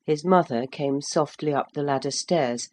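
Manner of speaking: plain reading voice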